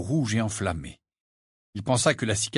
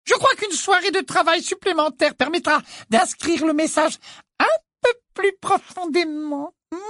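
wicked stepmother; 4 dB louder than usual. A narrator's reading. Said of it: "avec un sourire toujours aussi large".

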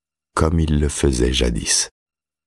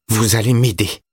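put-on higher-pitched boy's challenge; defiant demand in a raised voice; 7 semitones higher than usual. A narrator's reading. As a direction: throw it down as a dare. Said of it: "comme un défi".

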